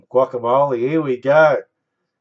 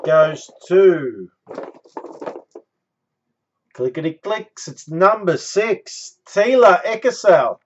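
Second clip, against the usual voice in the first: loud, higher bingo-style calling; bingo caller; 4 semitones higher, 7 dB louder than usual. Acted. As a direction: call the winners like a bingo host. Hosts randomizer like bingo caller.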